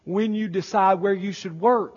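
ordinary talk

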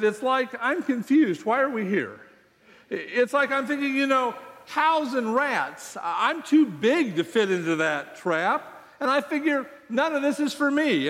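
high, puzzled cow voice; confused cow voice. Acted.

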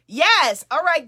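loud high yes to the crowd; excited shout. Real, not acted. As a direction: burst out with a cheer. Hyping up the live audience.

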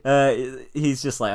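ordinary talk

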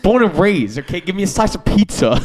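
hammed-up Bronx accent. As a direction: play a pushy New Yorker ordering pizza, in a Bronx accent. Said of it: "You sound like you're from Boston or the Bronx".

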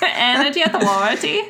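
laughing through a hammed-up Swedish accent; mock Swedish accent, laughing. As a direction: ham up a Swedish official, giggling. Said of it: "Say that in a Swedish accent".

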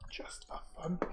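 soft unvoiced whisper; whispered aside. Real, not acted. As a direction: breathe it to yourself. DM's muttered aside.

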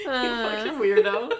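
laughing while teasing. Real, not acted. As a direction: tease through laughter. Laughing at friend.

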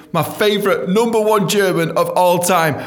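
high, loud hype announcement; big showman shout-out; excited shout out. Real, not acted. Shout out to fan.

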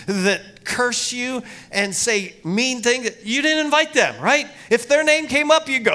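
loud, high preacher's build; preaching at full force. Acted. Pastor preaching hard to the congregation, loud and high.

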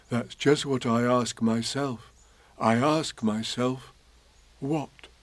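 lightly voiced bear, slow; puzzled teddy bear; mild Pooh voice; slow Pooh musing.

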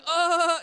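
loud, high mock groan of horror; terrified groan. Freshman hears her pastor's answer, horrified. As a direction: imitate an appalled teen's cry. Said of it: "She was so terrified to see me".

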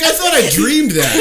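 high, loud, bewildered disbelief; baffled. Retold or imitated. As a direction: squeak it out confused. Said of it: "You're like".

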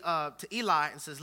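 ordinary delivery

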